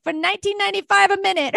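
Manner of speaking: a fast TV psychic pitch in a put-on Jamaican accent; imitating Jamaican accent. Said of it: "she was the Jamaican".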